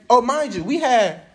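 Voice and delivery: ordinary talk, nothing marked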